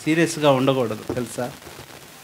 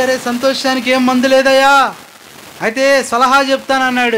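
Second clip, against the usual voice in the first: preaching at full force; 9 semitones higher, 5 dB louder than usual. Acted. Preacher pressing the congregation about joy, at full force. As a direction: pound out each line from the pulpit. Preacher voicing the doctor loudly.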